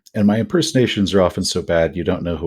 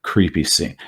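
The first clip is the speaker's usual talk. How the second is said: normal talking voice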